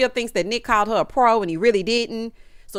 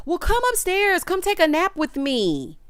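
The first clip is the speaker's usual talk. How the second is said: high, sing-song invitation; mock coaxing; 8 semitones higher than usual. Imitating a housemate coaxing a man upstairs, high. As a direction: coax him sweetly. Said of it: "She gonna tell him".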